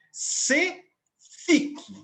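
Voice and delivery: "se" and "fique" separated, both pitched high; syllable demonstration. Shown as a demonstration. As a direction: count out "se … fique" with a clear gap. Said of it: "a estrutura de sílabas". ("se fique" escandido, em tom alto)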